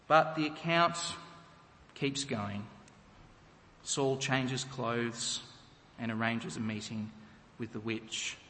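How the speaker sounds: ordinary talk, nothing marked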